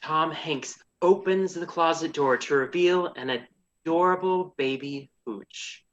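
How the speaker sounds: ordinary delivery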